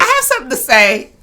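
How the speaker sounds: eager. Retold or imitated. Eager interruption in retold scene.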